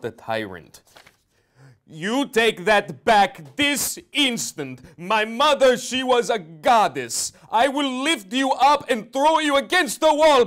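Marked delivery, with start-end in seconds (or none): 1.9-10.5 bellowing fury, over the top; sudden rage. Acted. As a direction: explode at him in outraged defence of mother. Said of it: "That was a rare show of aggression".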